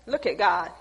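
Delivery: normal talking voice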